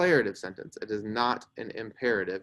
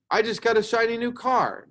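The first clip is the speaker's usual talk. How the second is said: high-pitched, thrilled announcement; exclamatory example read with excitement; excited exclamation; 10 semitones higher, 4 dB louder than usual. Shown as a demonstration. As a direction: exclaim it with delight, pitch well up. Reads example of exclamatory sentence.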